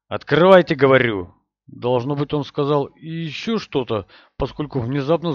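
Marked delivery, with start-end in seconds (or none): 0.1-1.3 furious shout. Acted. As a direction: bellow the order at the door. Villain demands door opened.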